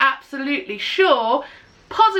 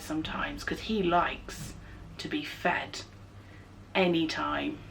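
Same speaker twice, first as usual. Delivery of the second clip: whisper; 8 semitones lower, 14 dB quieter than usual. Real, not acted. Aside about her cat.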